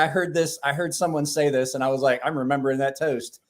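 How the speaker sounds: normal talking voice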